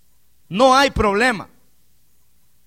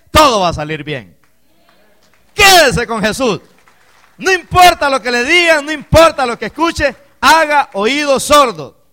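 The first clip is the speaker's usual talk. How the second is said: loud, slow, higher preaching push; full-force preaching; 6 semitones higher, 7 dB louder, slower than usual. Acted. Preacher exhorting the congregation to stay with Jesus, loud and slow. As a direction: hammer the promise home to the church.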